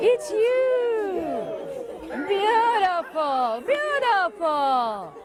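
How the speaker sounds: high gasping, laughing greeting; delighted. Shown as a demonstration. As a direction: gasp and beam at each person. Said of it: "one, two, three, go".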